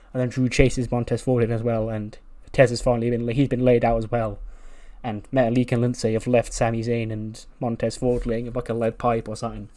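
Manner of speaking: ordinary talk, nothing marked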